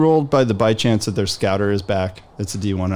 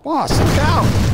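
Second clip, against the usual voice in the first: high, shrill-free panicked cry; panicked high warning; 4 dB louder, much slower than usual. Acted. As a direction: yell a frantic warning up high. NPC shouts warning again.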